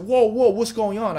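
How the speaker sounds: reliving the startled shout at the scream; retold alarm. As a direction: jump in alarm as if hearing a scream.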